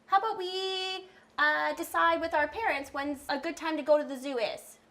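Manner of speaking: a hint of an eager friend on the phone, high; pretend call. Therapist playing Sarah, pleased to get the invitation. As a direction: play the delighted friend answering.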